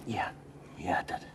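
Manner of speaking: soft whispered reply. Acted. Husband to wife at night, quiet whisper. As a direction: hush the answer. Hushed late-night talk.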